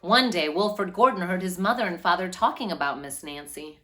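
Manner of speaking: steady, clear storybook reading; gentle read-aloud to children. A narrator's reading. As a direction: read it like a picture book for a class. Story narration continues.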